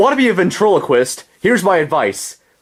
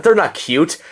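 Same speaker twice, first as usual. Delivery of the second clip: normal talking voice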